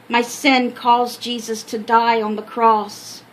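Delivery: ordinary delivery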